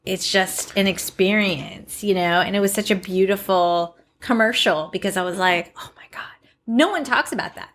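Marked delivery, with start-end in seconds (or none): 5.8-6.2 whispered, retold amazement; hushed awe. Recalling her reaction to the commercial, hushed whisper. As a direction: drop to an awed whisper.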